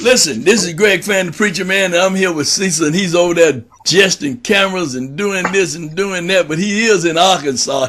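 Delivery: loud, energetic host opener; big preacher-man welcome; booming show intro. Real, not acted. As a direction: announce yourself like a preacher on air.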